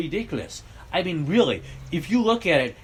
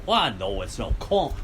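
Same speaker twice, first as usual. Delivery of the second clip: usual speaking voice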